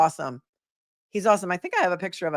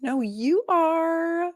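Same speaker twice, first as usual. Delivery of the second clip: playful high reply; 12 semitones higher, much slower than usual. Real, not acted. Answering a viewer's sweet message.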